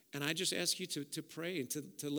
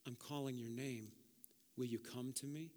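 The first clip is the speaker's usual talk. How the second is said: gentle whisper; 4 semitones lower, 9 dB quieter than usual. Acted. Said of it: "hear him speak and whisper to you".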